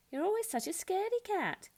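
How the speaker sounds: high, teasing call; high mocking taunt. A narrator's reading. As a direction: tease her little sister from afar. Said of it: "Sheila Ray called".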